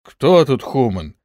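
challenging retort; defiant. A narrator's reading. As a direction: throw the insult back at him. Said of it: "с вызовом в голосе".

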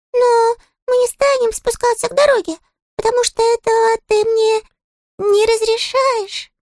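very high, tiny sulky voice; a small touchy critter, piping high; squeaky little creature. Acted. Щепкин's character voice.

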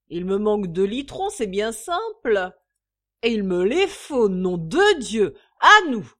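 loud insistent swearing; drunken demand. A narrator's reading.

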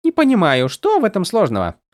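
high, light and teasing; a tiny sprite needling her friend; playful spren voice. A narrator's reading. Голос Сил. (высокий игривый голос духа)

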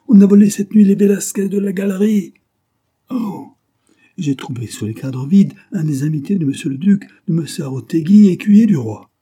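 high, loud, panicky report; aghast, breaking the news at full pitch; frantic alarm. A narrator's reading. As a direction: cry out the terrible news. Majordomo frantically reports theft.

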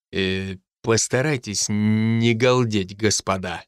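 low, measured schoolmaster request; professor's caution. A narrator's reading. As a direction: ask for order with halting deliberation.

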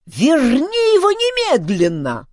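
high, loud furious howl; squealing, outraged order; old man yelling. A narrator's reading. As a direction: holler a demand as an angry old chief. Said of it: "завопил старик".

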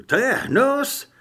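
high, loud, drawn-out exclamation; disgusted cry. A narrator's reading. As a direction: cry out in disgust, stretching the words. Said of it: "vykřikl Trip".

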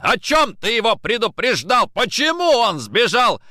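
high, loud interrogation; angry shouting. A narrator's reading. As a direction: yell accusing questions. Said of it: "закричал Никитин".

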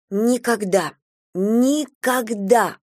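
slow, half-choked vow. A narrator's reading. Girl vowing to grandmother never to learn music, half-choked. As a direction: squeeze the vow out, strangled. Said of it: "полузадушенно пообещала я".

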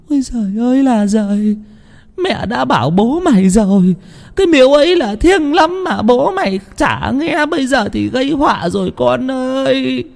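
whimpering lament. A narrator's reading. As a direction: lament as if about to cry. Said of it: "bà mếu máo".

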